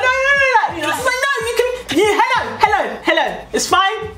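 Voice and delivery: very high, flustered protest; hammed-up panicky squeal, rushing to stop him backing off; squeaky, over-eager insisting; frantic eagerness. Flustered eager refusal to let him back off.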